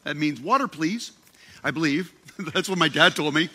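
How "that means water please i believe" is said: normal talking voice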